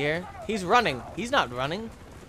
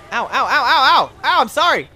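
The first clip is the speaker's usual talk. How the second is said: high, loud laughing ow ow; laughing yelps; 8 semitones higher, 10 dB louder than usual. Real, not acted. Gamer hit by gunfire laughing out ows. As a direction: yelp through laughter.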